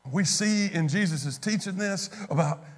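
ordinary delivery

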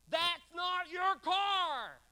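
slow, loud, high insistence; loud declaration. Pretend. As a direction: declare it loudly, slowly. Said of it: "you're gonna use a loud voice".

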